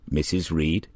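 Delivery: plain reading voice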